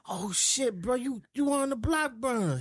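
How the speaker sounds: high, excited recognition; light up at a famous face. Retold or imitated. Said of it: "I'm like".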